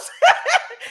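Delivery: squealing high laugh; high burst of laughter. Real, not acted. Laughs mid-story.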